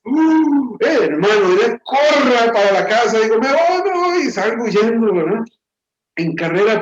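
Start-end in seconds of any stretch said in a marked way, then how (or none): 0.0-0.7 a high startled uh, caught late; startled gasp. Retold or imitated. Boy realizes the time and gasps, startled. 3.4-4.3 high, urgent me voy, me voy; panicked hurry. Retold or imitated. Boy rushing off to beat mom home, panicked.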